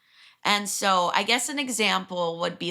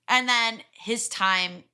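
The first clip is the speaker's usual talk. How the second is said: ordinary talk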